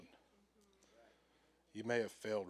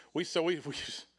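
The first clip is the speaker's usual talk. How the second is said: normal talking voice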